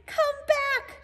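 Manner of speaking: high squeaky mock shout; squirrel shouting. Searle the squirrel calling to the bird, high mock shout. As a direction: call after the bird in alarm. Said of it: "shouted Searle".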